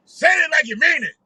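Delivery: high, loud preacher call to the crowd; rally the crowd like a fiery preacher; mock rally preacher exhorting. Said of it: "he sounded like he sounds".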